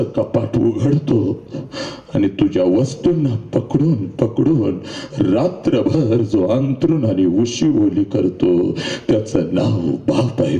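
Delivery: low, slow, heavy preaching; slow emotional preaching. Acted. Speaker tells daughters of father's grief, low and slow. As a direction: let each word sink, low and slow.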